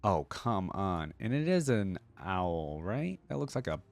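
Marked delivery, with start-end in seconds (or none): none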